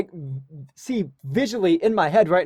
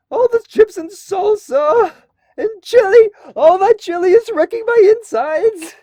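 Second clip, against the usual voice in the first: put-on high, loud, strained teen voice; pubescent Boy Scout voice; 7 dB louder, slower than usual. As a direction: voice cracking kid at camp. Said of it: "this is how I sounded like when I was in Boy Scouts".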